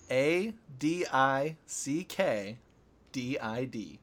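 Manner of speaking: "A D I C K D I D" spelled slowly letter by letter. Shown as a demonstration. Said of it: "let me spell it for you".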